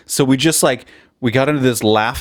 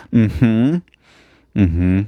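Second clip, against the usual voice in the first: mocking laugh. Gloating at Elon Musk.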